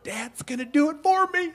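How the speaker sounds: put-on high, pleased little-girl voice; a lightly voiced delighted daughter; gleeful kid voice. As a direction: play the kid who thinks she's off the hook. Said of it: "you know what she heard".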